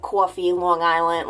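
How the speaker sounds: put-on old Long Island accent on coffee; Long Island vowels on coffee. Shown as a demonstration. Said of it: "biggest Long Island accent ever".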